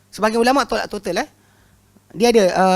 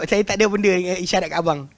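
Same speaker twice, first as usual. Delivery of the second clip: normal talking voice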